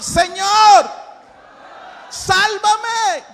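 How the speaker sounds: full-force high shouted prayer. Shown as a demonstration. Preacher leads the congregation in crying to the Lord, shouting. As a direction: shout it to heaven, each word held. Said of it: "levantar su voz conmigo".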